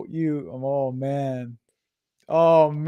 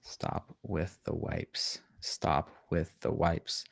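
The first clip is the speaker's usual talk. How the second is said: slow breathy whisper of the slogan; 12 semitones lower, 13 dB quieter, much slower than usual. Shown as a demonstration. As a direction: whisper it slowly, like a secret.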